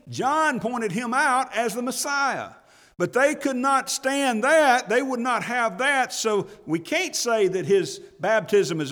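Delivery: high, loud, driving sermon voice, rising up; full-force preaching. Acted. Preacher to his flock, preaching at full force. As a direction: preach it out full throttle to the congregation.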